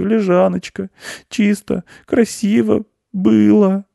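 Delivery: high-pitched plaintive little mouse, close to tears; whiny, hard-done-by mouse squeak; nearly crying. A narrator's reading. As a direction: complain tearfully in a high little voice. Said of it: "Мышь уже чуть не плакал".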